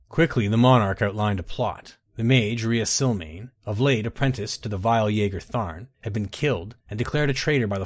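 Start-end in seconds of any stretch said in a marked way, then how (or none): none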